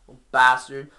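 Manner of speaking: ordinary talk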